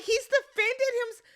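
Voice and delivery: hammed-up high voice parroting a castmate; mocking Sierra. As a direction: squeak it out like a clueless contestant. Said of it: "Sierra is like".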